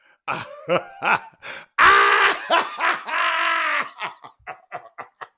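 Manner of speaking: high, loud belly laugh trailing off; big loud laugh. Real, not acted. Host cracking up at silent audience, big laugh. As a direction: burst out laughing long and hard.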